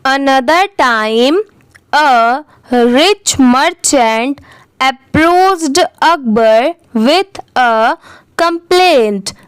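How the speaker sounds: very slow, word-by-word reading with pauses; careful model reading. Shown as a demonstration. Teacher reading the paragraph for learners, slow and carefully spaced. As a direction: read it out slowly, each word separate. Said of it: "एक बार अच्छे से read करके".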